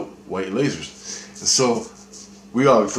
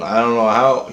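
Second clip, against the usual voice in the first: normal talking voice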